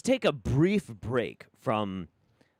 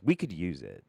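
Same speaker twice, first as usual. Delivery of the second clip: ordinary talk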